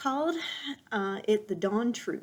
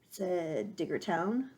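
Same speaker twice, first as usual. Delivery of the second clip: usual speaking voice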